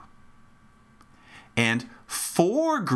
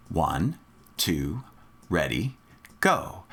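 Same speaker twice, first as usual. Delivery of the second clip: slow, evenly spaced low count, rising on go; 7 semitones lower, slower than usual. Shown as a demonstration. Teacher cueing students to start together, measured count-off. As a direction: count them in on a steady beat. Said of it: "I'll count off first".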